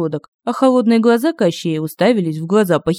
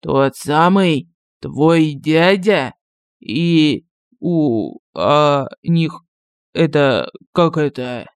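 slow, lower, halting disbelief; frightened stammer; 4 semitones lower, much slower than usual. A narrator's reading. Captor learns who the uncle is, halting frightened stammer. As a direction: stumble over the words in dread.

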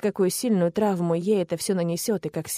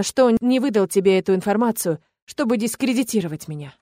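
plain reading voice

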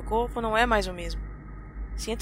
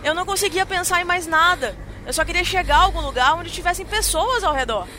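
raised, louder panicked telling; panicking; 6 semitones higher, 4 dB louder than usual. Acted. Frightened woman recalling her attempt to flee the man, panicking. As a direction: build from dread into frantic fear.

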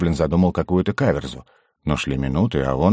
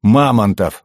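explosive outburst; 4 semitones higher, 6 dB louder, slower than usual. A narrator's reading. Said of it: "взорвался гном".

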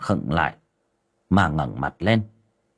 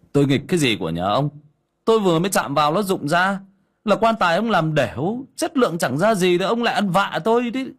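scolding; 10 semitones higher than usual. Acted. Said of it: "nói như mắng".

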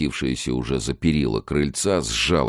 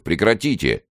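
raised-pitch command to stop; shouted order; 7 semitones higher, 4 dB louder than usual. A narrator's reading. Lieutenant stopping the struggling wife, shouted order. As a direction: order them to break it up. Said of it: "крикнул Давыдычев".

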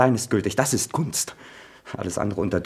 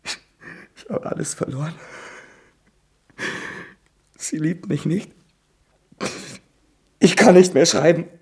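despairing; 7 semitones higher than usual. Acted. Son despairs after quarrel.

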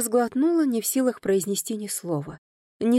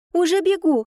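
put-on high, louder, eager young maid calling back; ringing girl's voice; 9 semitones higher, 6 dB louder than usual. A narrator's reading. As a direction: shout it up high like a quick little servant girl. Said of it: "ответил ему звонкий голосок".

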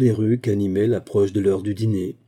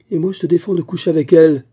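hurled back, much louder and higher, falling hard on elle; brutal retort; 7 semitones higher, 8 dB louder, faster than usual. A narrator's reading. Wife turning the husband's ban against him, brutal and loud. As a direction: throw his words back at him brutally. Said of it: "avait jeté ces mots avec brutalité".

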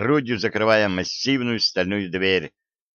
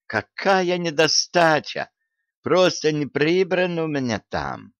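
wailing protest; 8 semitones higher than usual. A narrator's reading. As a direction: keep wailing that nothing is missing. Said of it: "взвыл казначей".

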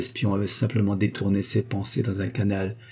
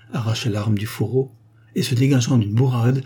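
ordinary delivery

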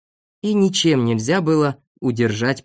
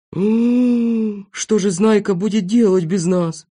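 higher character voice after a long hum; hum, then wonder aloud in a higher pitch; musing worry; 6 semitones higher, slower than usual. A narrator's reading. Passenger wonders about the departed leader, musing.

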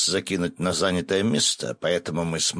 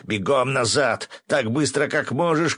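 urgent shout, climbing higher; shouted order; 7 semitones higher than usual. A narrator's reading. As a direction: urge him on with a shout. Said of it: "крикнул я Максу". (срочный крик, всё выше)

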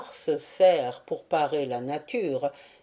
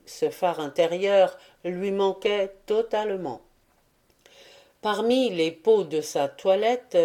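ordinary delivery

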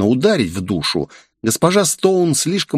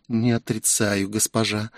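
much softer, calming servant; soothing quiet; 7 dB quieter than usual. A narrator's reading. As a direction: hush her gently. Джейн успокаивает госпожу. (тихо и успокаивающе)

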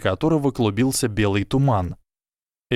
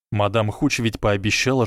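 ordinary delivery

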